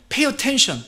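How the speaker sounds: "Pay attention!" shouted high, with "pay" hit hard; strong shout. Acted. Parent yelling "Pay attention!" at a child in danger. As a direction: call out "Pay attention!" in alarm. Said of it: "이렇게 강하게 말을 하지 않습니까".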